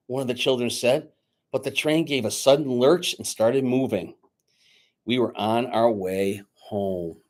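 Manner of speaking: lively read-aloud narration; storyteller reading a Christmas book aloud; story narration. A narrator's reading. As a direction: tell it to a room of children. Reads story aloud to children.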